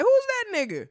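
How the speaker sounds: high, loud shocked question; surprised. Retold or imitated. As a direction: react in disbelief. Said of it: "I was like".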